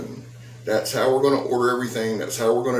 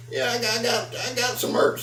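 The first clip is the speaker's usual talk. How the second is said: much higher silly squeal; squeaky excited voice; 13 semitones higher than usual. Pretend. Showing off new merch to viewers, high squeaky voice. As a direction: squeal it like a giddy kid.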